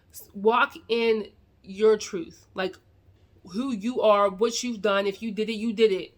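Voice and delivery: ordinary talk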